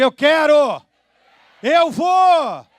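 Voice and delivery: loud, high shout of quero and vou; shouted declaration. Shown as a demonstration. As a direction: cry it out with full conviction.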